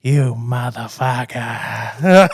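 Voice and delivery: slow, drawn-out mock Boston movie accent; mocking bad Boston accent. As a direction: drag it out like a badly faked Boston movie line. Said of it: "she looks at Johnny Depp and she goes".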